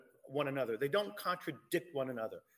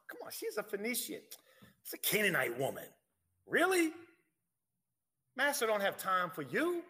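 mock disciples' scoffing; 4 semitones higher than usual. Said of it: "What's going on in these disciples' minds?".

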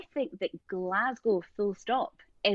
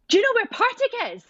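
flustered; 6 dB louder than usual. Retold or imitated. Asking elderly couple, flustered.

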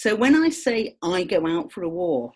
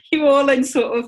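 laughing tease; 4 semitones higher, 5 dB louder than usual. Real, not acted. Teasing the listeners, laughing.

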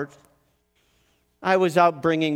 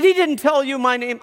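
higher, louder accusing retort; defiant challenge; 9 semitones higher, 5 dB louder than usual. Retold or imitated. Shame disputes the narrator's claim, high and defiant. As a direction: dare him to prove it.

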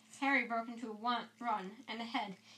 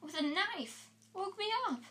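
frightened insistence; 8 semitones higher than usual. Acted.

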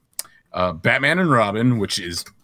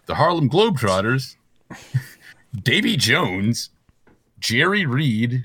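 normal talking voice

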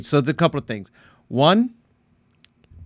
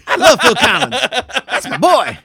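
laughing; 10 semitones higher, 5 dB louder than usual. Real, not acted. Laughing banter about the mix.

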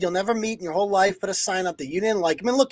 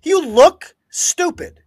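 slow, higher, louder schoolyard sneer; mocking taunt; 8 semitones higher, 5 dB louder, much slower than usual. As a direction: drag it out to mock him.

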